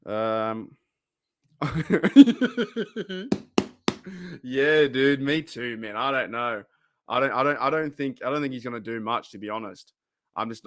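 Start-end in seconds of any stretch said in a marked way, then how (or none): 1.6-4.4 long, high chuckle; real high-pitched laughter; amused laugh. Real, not acted. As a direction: break into a drawn-out giggle. Laughs reading viewer comments.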